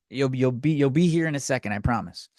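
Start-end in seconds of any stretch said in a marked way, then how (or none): none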